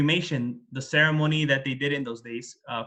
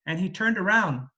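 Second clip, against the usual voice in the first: usual speaking voice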